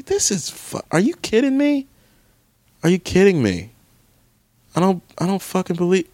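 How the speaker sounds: high, disbelieving cry of frustration; incredulous outburst. Real, not acted. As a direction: squeal it in disbelief.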